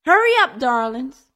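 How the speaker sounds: bright, high, sing-song hurry; forced cheer. A narrator's reading. Mother hurrying the children to dinner, forced cheerful. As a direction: fake a cheerful call to the children. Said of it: "she mustered a cheerful tone".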